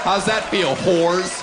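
loud jeering shout at the crowd; mock taunt. Real, not acted. Comic taunting the audience with a shout. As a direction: yell it right at them.

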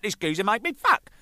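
high, loud complaint; loud acted exasperation; retold indignant protest. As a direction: protest hotly, acting out the row. Retold row with bandmate.

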